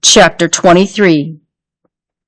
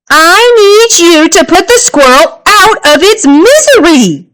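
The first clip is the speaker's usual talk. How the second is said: mother's firm voice; 10 semitones higher than usual. A narrator's reading.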